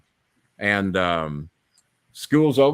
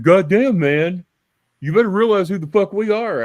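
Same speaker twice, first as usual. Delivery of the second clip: loud, high mock-angry snarl; quarterback's threat; 8 semitones higher, 5 dB louder than usual. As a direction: bark it like a cocky jock.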